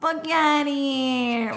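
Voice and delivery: high, stretched-out New Jersey dismissal; New Jersey accent. Acted. Repeating what the card said, in a drawn-out New Jersey accent. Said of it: "Say it in a New Jersey accent".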